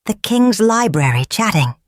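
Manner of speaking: ordinary delivery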